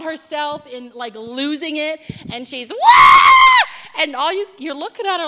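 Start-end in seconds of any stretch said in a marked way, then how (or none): 2.7-4.0 high loud wail; a hysterical high cry; wailing shout. Retold or imitated. Woman losing it, shouting.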